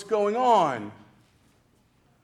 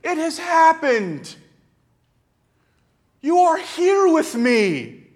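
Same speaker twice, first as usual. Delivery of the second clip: higher, louder burst of joy; joyful recognition; 8 semitones higher, 6 dB louder than usual. Acted. Mary realises Jesus is before her, joyful and loud. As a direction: cry out in disbelieving delight. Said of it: "with this sense of".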